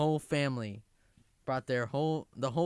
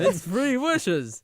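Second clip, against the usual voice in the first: slow, loud drawn-out genie; Robin Williams genie; 3 semitones higher, 9 dB louder, much slower than usual. Acted. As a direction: stretch it out like a showman genie. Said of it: "that was good".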